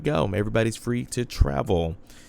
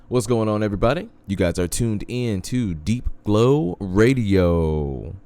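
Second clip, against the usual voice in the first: radio announcer voice; 2 semitones higher, 3 dB louder than usual. Acted. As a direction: draw it out like a DJ tagline. Radio host tagline.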